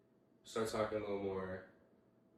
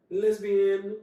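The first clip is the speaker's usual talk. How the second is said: mock shout; 13 semitones higher, 13 dB louder than usual. Listing girls who rejected him.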